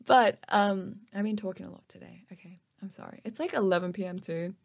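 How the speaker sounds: normal talking voice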